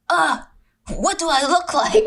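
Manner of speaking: high, laughing self-conscious yelp; mock panic. Woman just woken by FaceTime fretting over looks, mock panic.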